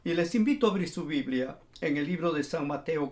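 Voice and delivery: ordinary talk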